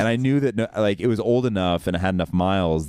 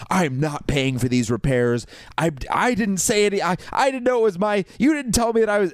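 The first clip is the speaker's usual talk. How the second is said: frustrated rant; 12 semitones higher than usual. Retold or imitated. Leaving voicemails refusing to pay for repairs, flustered. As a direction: pitch climbing much higher, flustered and pleading. Ranting voicemail to insurance agents.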